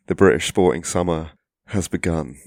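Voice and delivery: ordinary delivery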